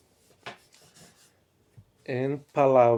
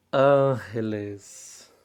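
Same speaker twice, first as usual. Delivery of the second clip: slow teaching demo; 3 dB louder, much slower than usual. Shown as a demonstration. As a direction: draw out "ángeles" slowly, every syllable clear. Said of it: "teacher models the plural slowly".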